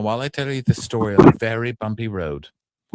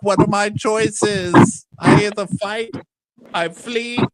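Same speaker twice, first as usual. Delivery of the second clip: much higher, slow and louder; mock dramatic dilemma; 13 semitones higher, 3 dB louder, slower than usual. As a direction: overwrought hero pondering fate. Darren voiced weighing choices.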